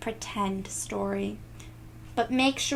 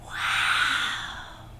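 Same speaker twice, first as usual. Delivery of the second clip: breathy whispered wow; awed wow. A narrator's reading. Reader sees the city view, whispered awed wow. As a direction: breathe out in wonder.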